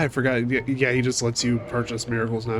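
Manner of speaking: ordinary talk, nothing marked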